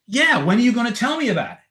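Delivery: high, exasperated imitation; impatient demand. Girlfriend still waiting to hear about the technique, impatient. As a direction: press for it, mock-impatient.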